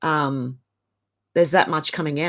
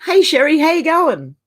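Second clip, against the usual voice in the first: high, loud cheery hello; light up to greet a friend; bright sing-song hello; excited greeting; 9 semitones higher, 5 dB louder than usual. Real, not acted. Greeting a viewer in live chat.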